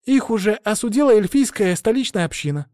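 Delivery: relieved novice. A narrator's reading. Novice adds that capital elves condemned it, relieved. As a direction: add good news in a high girlish pitch. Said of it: "добавила послушница с явным облегчением".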